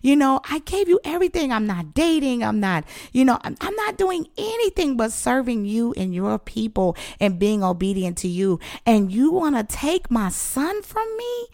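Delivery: high, wailing list of sacrifices; retold crying plea. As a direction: re-enact pleading with God, pitch held high. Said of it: "And I'm just crying".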